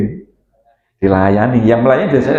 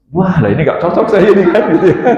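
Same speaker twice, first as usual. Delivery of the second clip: higher-pitched, laughing retold reaction; amused retelling; 4 semitones higher than usual. Speaker recalls his first matchmaking meeting, amused and laughing. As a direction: retell it through a light laugh.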